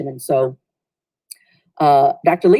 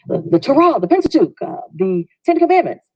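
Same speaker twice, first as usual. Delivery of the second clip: higher, eager shouted answers; class yelling out; 7 semitones higher than usual. Retold or imitated. Class shouts answers to the professor, mild mock yell. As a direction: call out the answers like a keen class. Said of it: "we yell out".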